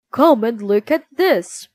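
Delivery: loud calling shout; excited shout. Acted. Soldier calling his friends to the frisbee, loud shout. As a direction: call the others over to see. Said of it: "he shouted".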